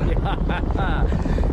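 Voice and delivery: a loud, proud laugh; hearty laugh. Acted. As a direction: laugh with pleasure. Proud of his horse.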